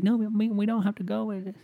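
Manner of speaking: put-on very high, swooning girlfriend. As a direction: coo it high and dreamy. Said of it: "She's like".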